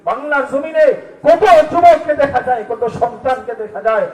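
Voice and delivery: loud, high, forceful preaching; fiery rebuke. Acted. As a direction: thunder against disrespectful children. Preacher rebukes scolding children.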